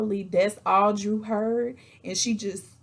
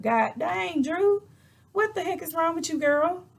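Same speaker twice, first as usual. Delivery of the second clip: high-pitched, fed-up questioning; exasperated; 10 semitones higher than usual. Real, not acted. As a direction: scold her from afar, pitched way up. Her own exasperation with Drew.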